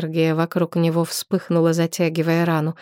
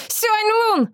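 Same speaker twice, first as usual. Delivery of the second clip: cracking shout. A narrator's reading. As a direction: scream the name until the voice cracks. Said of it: "Её голос сорвался".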